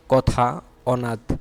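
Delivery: teacher modelling "কথা" and "অনাথ" with the open অ sound; model pronunciation. Shown as a demonstration. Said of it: "এগুলো হচ্ছে অ বর্ণের স্বাভাবিক উচ্চারণ".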